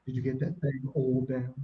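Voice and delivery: low, hushed put-on voice; mocking imitation. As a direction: mimic his talk, dropped low and soft. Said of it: "why you gonna talk like that".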